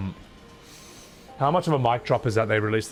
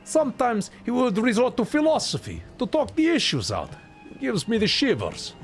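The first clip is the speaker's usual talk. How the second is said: higher, hammed-up amateur Russian accent; bad Russian accent; 9 semitones higher than usual. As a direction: read it grandly in a badly faked Russian accent. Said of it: "I got a bad Russian accent".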